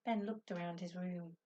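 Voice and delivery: plain reading voice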